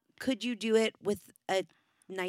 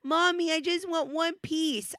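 put-on high, slow whining child; whiny child; 9 semitones higher, 4 dB louder than usual. Mother voicing her daughter whining for candy, high and slow. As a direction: whine like a toddler wanting candy. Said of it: "child whining for candy".

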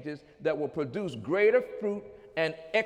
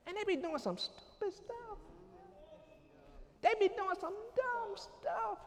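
mock high voice; 12 semitones higher, 11 dB quieter than usual. Mocking immature people's antics.

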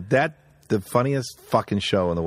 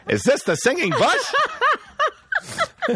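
high, slow delivery through laughter; laughing, high-pitched mock question; movie quote; much slower than usual. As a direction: call it out as a drawn-out movie line. Quoting Three Amigos to bush.

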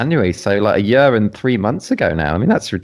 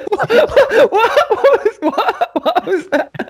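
high-pitched laughing question, much higher; cracking up while asking what he said; laughing disbelief; much slower than usual. Real, not acted. Laughing at a mispronunciation.

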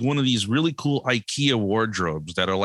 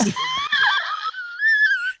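big high laugh. Real, not acted. Both laughing at the fall.

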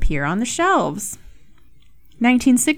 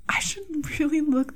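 whispering; 7 semitones higher, 6 dB quieter than usual. Real, not acted. Embarrassed aside after mispronouncing.

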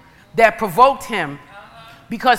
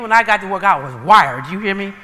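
normal talking voice

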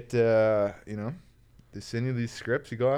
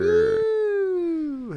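excited cheer; 6 dB louder than usual. Real, not acted. Guest cheers on his entrance.

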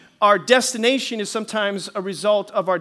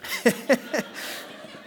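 short breathy laugh; 4 semitones higher than usual. Real, not acted. Laughing after the punchline.

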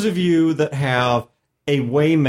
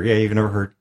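normal talking voice

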